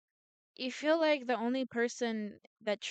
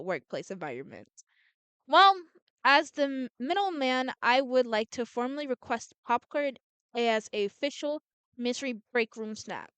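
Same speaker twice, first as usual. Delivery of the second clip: plain reading voice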